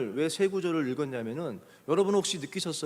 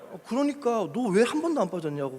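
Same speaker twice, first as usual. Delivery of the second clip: raised-pitch retold insistence; insistent questioning; 6 semitones higher, 4 dB louder than usual. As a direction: press the question on someone who missed the point. Retold pastor presses the question again. (답답한 듯 높여서 다그치는 흉내)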